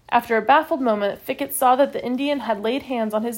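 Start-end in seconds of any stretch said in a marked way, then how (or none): none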